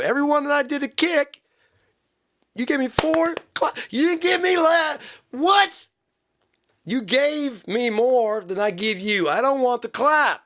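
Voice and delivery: high-pitched ranting outrage; hammed-up, spluttering high yell; exasperated yelling. Musician refusing the clap, mock exasperated.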